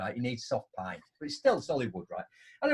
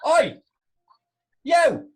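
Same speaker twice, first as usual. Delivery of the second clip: loud mock yell of a steward; shouted "Oi, you!"; retold shout; 13 semitones higher, 10 dB louder than usual. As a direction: bark it across the car park. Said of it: "and he went".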